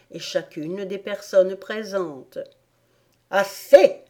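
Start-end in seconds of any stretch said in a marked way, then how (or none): 3.3-4.0 loud, high cut-off command; imperious queen. A narrator's reading. Queen halts the bowing gardeners, loud and imperious. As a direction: stop them with a royal shout. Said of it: "dit la reine".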